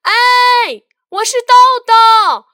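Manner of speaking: high, loud shout back; child shouting back to his mother from the park; loud answer. Acted. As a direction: shout back so mum can hear. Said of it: "大声地回答着".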